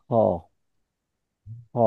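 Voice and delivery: ha pushed from the throat toward kha; guttural h. Shown as a demonstration. Teacher demonstrating the Arabic ha to a student, throaty. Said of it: "একটু practice করতে হবে".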